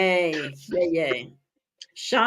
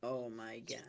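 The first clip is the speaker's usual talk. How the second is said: barely audible; 8 semitones lower, 21 dB quieter than usual. Real, not acted. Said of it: "Can't hear you".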